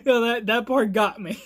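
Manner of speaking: loud, amused, still giggling; laughter lingering in the voice; laughing. Real, not acted. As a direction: admit it while recovering from a laughing fit. Recovering from laughing fit.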